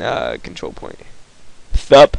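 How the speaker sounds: ordinary delivery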